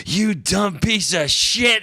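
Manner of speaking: high, loud, stretched-out holler; imitated angry yell. Ground man berating a hung climber, mock furious yell. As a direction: yell it up from far below. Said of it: "He's, like, yelling at me down there".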